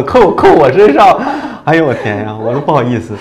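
laughing while telling; embarrassed laugh through words. Real, not acted. Embarrassed, laughing at own story.

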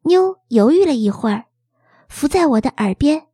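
plain reading voice

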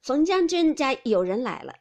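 loud servant's announcement; announcing a visitor. A narrator's reading. Servant reports the general's household arriving, calling announcement. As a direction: call it out to the whole floor. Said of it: "只见人报".

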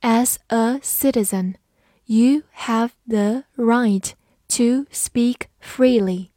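slow, word-by-word model reading. Shown as a demonstration. Teacher models the citizen sentence for learners, slow reading. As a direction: space out each word for learners. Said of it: "慢读一遍".

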